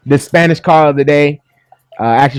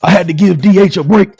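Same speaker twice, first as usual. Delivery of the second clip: higher preacher voice with pulpit swagger; host playing a visiting bishop; Bishop D H Jakes; 4 semitones higher than usual. Host introducing himself as a bishop, preaching cadence. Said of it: "This is Bishop D H Jakes".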